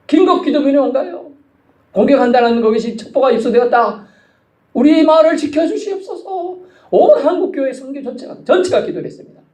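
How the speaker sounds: high, urgent prayer appeal; fervent preaching. Real, not acted. Preacher retelling missionaries' plea for protection, fervent preaching. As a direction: cry out the urgent report, pitched high.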